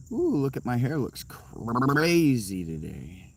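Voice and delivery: slow, preening over the hair; a hint of a vain model; mock vain drawl. Admiring his own messy hair, slow and preening. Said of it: "I'm into it".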